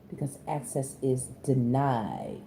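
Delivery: low, slow, soft "denied"; "denied" sinking slowly in a hushed low pitch; low verdict. Real, not acted. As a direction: draw out "denied" low and quietly. Repeats the verdict two ways.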